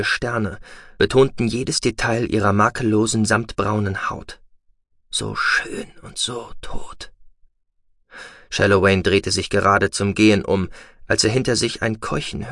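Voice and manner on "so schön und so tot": hushed whisper. A narrator's reading. Narrator voicing hero's grim thought.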